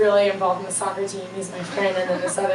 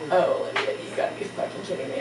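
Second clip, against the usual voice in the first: imitating a teacher's hushed, appalled whisper; mock-appalled under-the-breath whisper; whispered dismay; 3 semitones lower, 9 dB quieter, faster than usual. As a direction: whisper it in disappointed disbelief. Teacher shaking her head, silently appalled.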